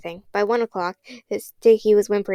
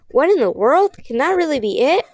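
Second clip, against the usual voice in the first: higher and louder, baffled; astonished; 6 semitones higher, 4 dB louder than usual. Acted. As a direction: react to a baffling message. Puzzling message arrives.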